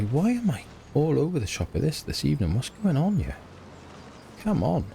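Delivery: high, swooping self-reproach; exasperated self-scolding. Real, not acted. Creator scolding himself for being all over the shop, exasperated. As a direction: complain at yourself, pitch leaping up high.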